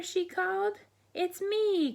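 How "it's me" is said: duckling's cry. Acted. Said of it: "cried Piddle".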